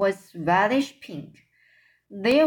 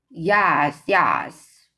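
lower, louder giant's boom; 3 semitones lower, 5 dB louder than usual. A narrator's reading. Blood bottler insists to the BFG, booming low. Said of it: "boomed the blood bottler".

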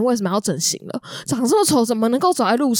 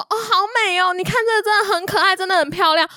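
gushing saleswoman; 8 semitones higher than usual. Retold or imitated. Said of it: "他就一直跟他说".

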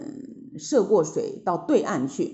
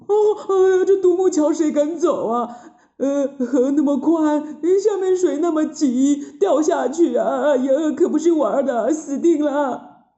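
terrified Zhu Bajie; 7 semitones higher, 4 dB louder than usual. A narrator's reading. Pig disciple dreads crossing the log bridge, terrified wail. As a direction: whine in hammed-up terror, much higher. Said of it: "猪八戒啊更害怕了".

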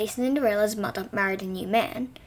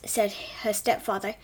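usual speaking voice

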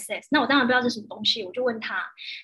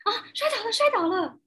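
mock worried mother; 9 semitones higher than usual. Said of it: "妈妈是不是要很急".

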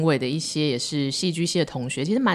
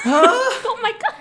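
much higher, drawn out with a laugh; laughing oh my god; 6 dB louder, much slower than usual. Real, not acted. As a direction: gasp it out through laughter. Reacting to her bad luck.

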